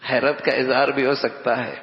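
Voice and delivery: ordinary talk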